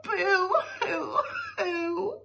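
high, long drawn-out boo-hoo; mock sobbing. Boy bursts into happy tears, hammed-up wail. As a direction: wail like a storybook crybaby. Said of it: "boy burst into tears".

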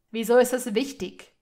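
loud, brusque question; irritated retort. A narrator's reading. Malfoy confronted by Hermine, irritated retort. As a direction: fire the question back at her. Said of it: "fuhr er sie an".

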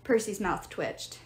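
usual speaking voice

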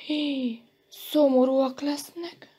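plaintive fellow-feeling; sympathetic. A narrator's reading. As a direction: sigh in sympathy. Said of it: "bólogatott együttérzőn Elemér".

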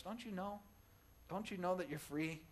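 Paul asking the church, softly and earnestly; quiet repeat. Retold or imitated. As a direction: bring it down to a quiet, earnest question. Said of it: "that's what Paul is saying here".

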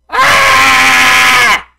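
very high shrieking scream. Real, not acted. Gamer shrieking at the game. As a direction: shriek in rage.

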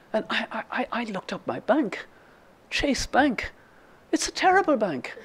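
high, soft, stammering dismay; imitating a friend's hushed indignation; dismayed confiding. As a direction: confide an appalling discovery, pitched high. Retells friend's dismay at her bank.

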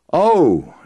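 high, falling "oh" of mock revelation; sudden realization. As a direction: act out the penny dropping. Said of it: "quintessential V eight moment".

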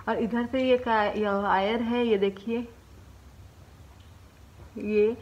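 lips stretched by fingers; talking with cheeks pulled open. Real, not acted. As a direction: mouth held wide showing braces. Seen 0: Fingers pull cheeks open to show braces, then hand drops by 4.3.

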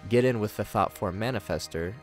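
usual speaking voice

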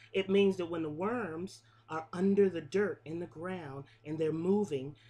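usual speaking voice

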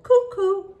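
high, loud sung-out cuckoo, falling; cuckoo call. Retold or imitated. Narrator imitating the cuckoo overhead, high bird call. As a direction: imitate the bird's two-note call. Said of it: "it called".

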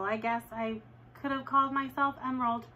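plain reading voice

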